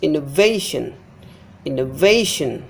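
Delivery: innovation as inno-VAY-shun; stress before suffix. Shown as a demonstration. Teacher showing suffix rule, innovation stressed on VA. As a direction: stretch and hit VA in innovation. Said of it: "Before this syllable is stressed".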